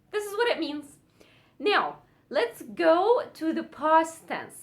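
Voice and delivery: ordinary talk, nothing marked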